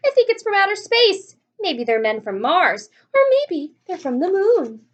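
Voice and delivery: loud, high shouted guesses; excited crowd shout. A narrator's reading. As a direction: yell up in wild excitement.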